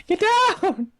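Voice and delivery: high-pitched pet-talk command; puppy scolding. Real, not acted. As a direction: squeal the order at your puppy. Ordering the puppy down.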